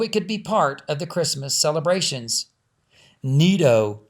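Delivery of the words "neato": louder delighted exclamation; boy thrilled by the light; excited cry. Acted. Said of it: "cried Doug".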